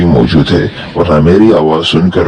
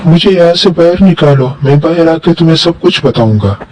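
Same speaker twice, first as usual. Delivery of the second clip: high, eerie voice calling from inside a chest; a trapped spirit pleading to be let out; muffled jinn voice; 11 semitones higher than usual. Acted. Jinn trapped in the chest begs the boy, eerie high voice.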